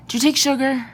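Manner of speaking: sudden, loud and high, the words just burst out; blurted out. Acted. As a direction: blurt it in a nervous rush. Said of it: "I blurt out".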